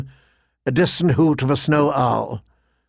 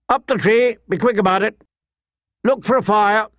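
bossy command; 8 semitones higher than usual. A narrator's reading. Lordling ordering, much higher.

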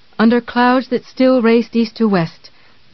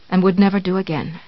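plain reading voice

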